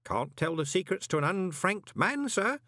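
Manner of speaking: put-on high, pious old postman; primly guard the secrets, pitched high. A narrator's reading. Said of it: "said Groat piously".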